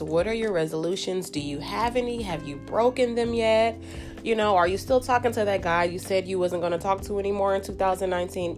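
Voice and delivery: ordinary delivery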